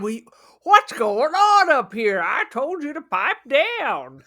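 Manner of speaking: loud, drawn-out scolding dad; angry dad. Acted. Dad bursting in on a noisy bedroom, angry and loud. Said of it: "then the dad came in".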